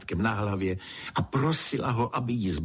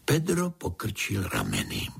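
plain reading voice

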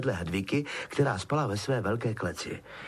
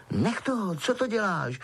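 urgent, higher-pitched reprimand; hissed; 7 semitones higher than usual. A narrator's reading. Harry stopping the elf hurting himself, hissing. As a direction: cut in to stop him at once. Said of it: "Sykl Harry".

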